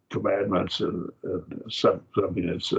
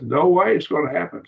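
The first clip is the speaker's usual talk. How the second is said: higher, louder punch on "no way"; emphatic denial; 8 semitones higher, 5 dB louder than usual. Shown as a demonstration. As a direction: hit "no way" high and loud, flatly ruling it out. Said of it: "get the emphasis out of it".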